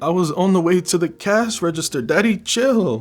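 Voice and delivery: high, loud mock protest; indignant protest. Caught shoplifter making excuses to the man, in high-pitched mock indignation.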